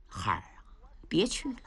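put-on whispered, breaking plea to a boy; old uncle whispering his nephew to stay; hushed plea. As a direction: whisper him back from danger. Yang Yao whispers to nephew.

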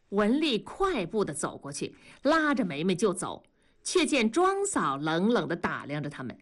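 ordinary delivery, nothing marked

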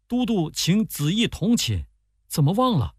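high-pitched, reminding reply; surprised attendant. A narrator's reading. Attendant reminding the commander of his guest, mild surprise.

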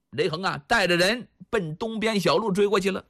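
ordinary delivery, nothing marked

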